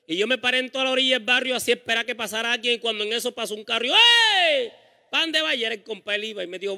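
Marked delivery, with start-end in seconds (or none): 3.9-5.7 loud hail to a passing car, pitched high; calling out. Retold or imitated. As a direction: shout to stop the car. Llamando al carro que pasa. (grito alto para parar un carro)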